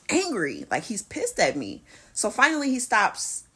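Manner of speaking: ordinary talk, nothing marked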